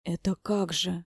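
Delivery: slow, stunned question; dumbfounded. A narrator's reading. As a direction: draw it out in disbelief. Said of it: "обомлел Хати". (обомлев, растерянно)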